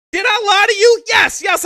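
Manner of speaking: loud and high, calling out the lie; ranting shout. Real, not acted. Streamer ranting at chat, loud and high. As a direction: hammer each yes loud.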